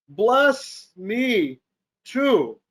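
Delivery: acted plea with a touch of bitterness; mild bitter cry. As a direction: cry it out word by word, restrained. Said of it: "exceedingly loud and bitter shout".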